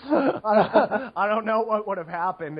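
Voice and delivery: laughing through the words. Real, not acted. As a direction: chuckle while talking. Laughs at anonymous personal question.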